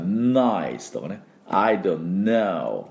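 long held N opening ナイス and know; slow, stretched N in ナイス and "know"; 粘るN. Shown as a demonstration. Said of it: "粘る納豆をイメージして、". (ナイス と know のNを長く粘らせて)

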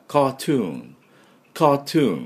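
British cartoon with weak r; British "カートゥーン". Shown as a demonstration. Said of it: "イギリスはこのRの音が弱い".

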